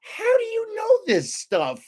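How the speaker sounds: very high, slow, astonished wife voice; Susie amazed. Retold or imitated. Wife turning to her husband after Oslo, squeaky high amazement. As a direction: squeak it out like a wife who can't believe it. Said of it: "she turns to me and goes".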